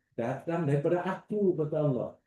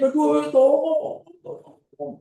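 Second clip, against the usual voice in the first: much higher, louder slow cry of aduh tolong, fading on the last tolong; screaming for help; 12 semitones higher, 5 dB louder, much slower than usual. Retold or imitated. Preacher voicing a trapped crash victim, shrieking for help. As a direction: yell for help as if pinned under a car. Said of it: "Menjerit-jerit".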